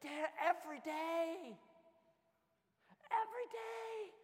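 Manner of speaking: slow, high drawn-out whine; whiny kid. Retold or imitated. Kid whining to dad about getting ready every day. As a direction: complain in a long whine. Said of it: "it's just like".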